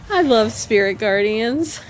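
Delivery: loud, gleeful exclamation; delighted. Real, not acted. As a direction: gush over it, thrilled. Delighted by spell.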